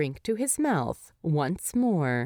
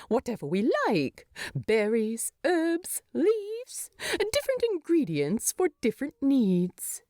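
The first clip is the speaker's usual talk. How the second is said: quick, higher, mildly affronted hobbit; a touch of indignation; 7 semitones higher than usual. A narrator's reading. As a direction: defend the tea, lightly peeved. Bilbo bristles over hobbit tea.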